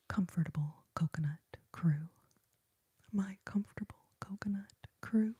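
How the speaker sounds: whispered "comfortable coconut crew" with crisp K sounds; tingly whispered "coconut crew"; ASMR trigger words. Shown as a demonstration. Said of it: "good trigger words".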